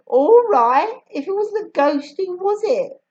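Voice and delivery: put-on a boy daring her to answer; Julius, challenging. A narrator's reading.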